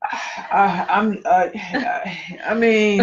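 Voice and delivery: flustered stammer, searching for words; stuttering. Real, not acted. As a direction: trip over the first words. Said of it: "you got me over here stuttering".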